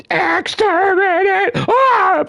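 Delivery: high screeching Dalek cry of exterminate. Retold or imitated. Fan acting out a Dalek attack, shrill screech. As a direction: shriek it like a killer robot.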